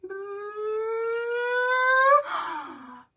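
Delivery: long, high rising squeal of anticipation; sustained high-pitched build-up sound. Pretend. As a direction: draw out a high squeal climbing to a gasp. Build-up to opening and seeing.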